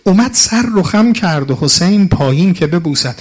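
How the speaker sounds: loud, high wailing sermon chant; mourning lament. Acted. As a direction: cry out the martyr's plea to the crowd. Rawza lament continues.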